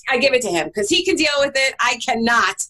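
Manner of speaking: usual speaking voice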